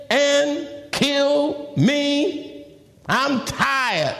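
high, slow, overburdened plea; worn-down cry of protest to God; frustrated Moses. Acted. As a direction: space the words out, pitched well up. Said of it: "He was so frustrated".

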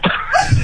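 short, high-pitched laughing burst; real laugh. Real, not acted. Shop man tries to answer the caller, high burst of laughter. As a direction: crack up again mid-sentence. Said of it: "I'm laughing, but".

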